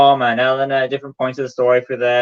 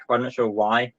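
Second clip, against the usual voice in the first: ordinary talk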